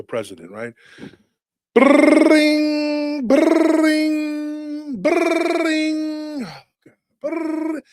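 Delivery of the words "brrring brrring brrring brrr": high buzzing phone ring imitation. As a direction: trill it like a ringing phone.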